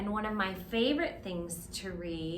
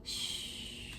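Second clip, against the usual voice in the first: whispered shh snore; gentle snore sound; soft snore sound; 11 dB quieter than usual. Shown as a demonstration. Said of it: "make your snore sound".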